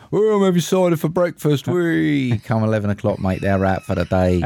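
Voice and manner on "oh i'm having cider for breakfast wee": lightly voiced, higher-pitched cider drinker; a hint of tipsy glee; mock merry drinker. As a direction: imitate a giddy morning boozer.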